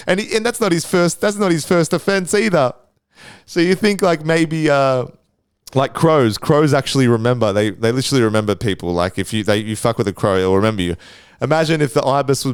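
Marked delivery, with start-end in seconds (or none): none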